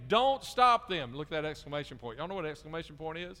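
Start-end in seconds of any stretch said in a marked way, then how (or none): 0.1-1.2 slow, high, loud command; a firm loud order from Jesus; forceful, stretched-out rebuke; loud and strong. Acted. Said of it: "he says it right, really loud and strong".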